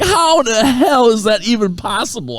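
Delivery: slow, high-pitched mock disbelief; incredulous. Friends dying laughing at the mix-up, high incredulous voice. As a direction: stretch it out high in amazement.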